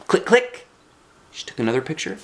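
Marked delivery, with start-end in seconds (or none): 0.1-0.7 high, bright mouth clicks like a camera shutter; camera click noise. Acted. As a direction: pop out two camera clicks, much higher. Camera clicking sound imitated.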